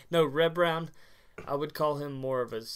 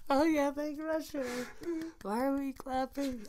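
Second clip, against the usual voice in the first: imitating puzzled crowd; 12 semitones higher, 5 dB quieter than usual. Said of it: "they all get".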